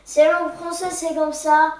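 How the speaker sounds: loud and high, fed up; loud, high "c'est la langue française"; exasperated. Acted. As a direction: throw up your hands, loud. Child exasperated explaining French.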